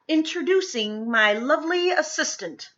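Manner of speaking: a hint of a stage magician; showman's announcement. A narrator's reading. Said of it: "mimed Luke".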